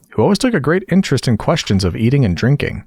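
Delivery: ordinary delivery, nothing marked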